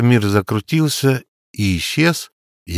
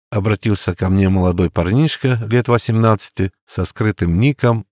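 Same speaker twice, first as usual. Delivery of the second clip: usual speaking voice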